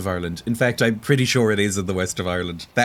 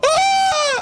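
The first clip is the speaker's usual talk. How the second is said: sentence cut off by a sudden high shriek; startled scream; 5 dB louder than usual. Real, not acted. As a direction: jump out of your skin mid-word. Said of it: "Before I was so rudely interrupted".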